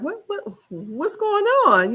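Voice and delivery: high, far-off mock confusion, pitched well up; confused what's going on. Host imitating Doctor Heaven asking what's going on, high and baffled. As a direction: play the baffled doctor catching up on gossip.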